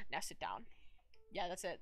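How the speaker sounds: whispered aside. Real, not acted. Vlogger drops out of the bit, whispered aside. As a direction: whisper it off to the side.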